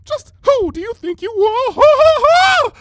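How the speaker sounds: high, loud, slow outraged yell; angry unicorn telling off a pony at the door; furious tirade. A narrator's reading. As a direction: blast him with indignation.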